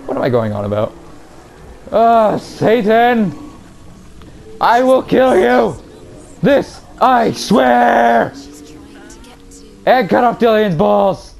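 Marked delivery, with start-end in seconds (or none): 1.9-11.3 dramatic shout. Acted. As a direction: roar the vow at the villain. Shouting vow at game boss.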